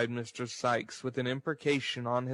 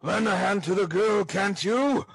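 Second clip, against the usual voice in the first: loud, high-pitched impatient order; exasperated bully's shout; 8 semitones higher, 7 dB louder than usual. Acted. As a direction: shout it irritably as a ruffian. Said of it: "replied Sikes impatiently".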